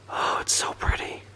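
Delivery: breathy, awed whisper of admiration. Real, not acted. Vlogger admiring his new purchase, whispering in awe. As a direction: hush your voice and marvel at it.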